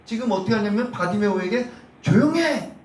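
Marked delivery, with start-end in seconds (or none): 2.0-2.7 high, loud crowd rebuke; scolding shout. Retold or imitated. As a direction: shush him with a sharp, raised shout. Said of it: "막 호통치는 거예요". (호통치듯 높고 크게)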